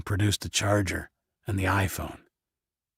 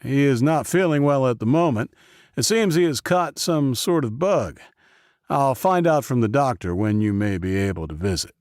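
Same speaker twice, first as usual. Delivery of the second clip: higher, louder official's voice; a brisk official breaking bad news; raised voice; 6 semitones higher, 6 dB louder than usual. Professor speaking to Isaac.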